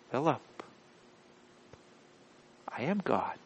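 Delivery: hushed, patient correction after a pause; Jesus. Acted. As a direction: say it very softly, spacing the name from the statement. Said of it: "I don't know how Jesus acted".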